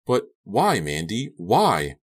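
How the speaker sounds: loud, high pleading question; anguished demand. A narrator's reading. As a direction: cry out for a reason. Captive pleads for a reason.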